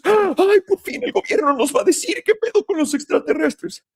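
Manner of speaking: very high, squealing mock delight; sarcastic, gleeful parody; mock gushing excitement. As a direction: squeal like a gullible fan finally getting the news. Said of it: "esa misma gente que dice".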